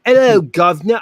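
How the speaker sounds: hammed-up London greeting; London accent. Shown as a demonstration. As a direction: do a movie-style London accent. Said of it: "do a London accent".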